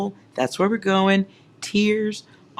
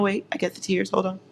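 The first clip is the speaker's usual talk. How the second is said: usual speaking voice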